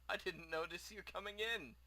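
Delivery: lightly voiced eager merchant; a hint of chatty shop owner; friendly shopkeeper. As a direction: greet a customer with mild theatrical lift. Plays the shopkeeper.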